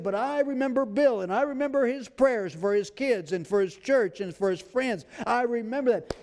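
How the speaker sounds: high, ringing voice of God naming Bill. Acted. God recalling Bill's prayers for his family, high and ringing. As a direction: declare the memory with conviction. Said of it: "He said".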